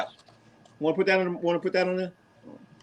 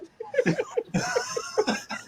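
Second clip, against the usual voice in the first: very high-pitched cackle; real high cackle; high squealing laugh; 6 dB quieter than usual. Real, not acted. Laughing at Steve.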